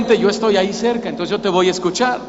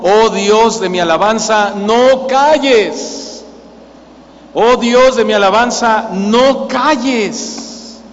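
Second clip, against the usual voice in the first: impassioned plea; much slower than usual. Acted. Preacher voices the psalmist begging God, impassioned plea. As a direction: implore God, drawing out the final words.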